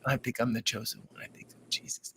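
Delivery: low, hushed confession fading to a whisper; confiding patient. Retold or imitated. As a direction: drop low and let it sink into a whisper. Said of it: "mess up also telling me".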